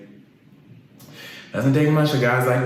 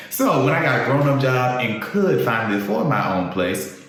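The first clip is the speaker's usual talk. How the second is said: normal talking voice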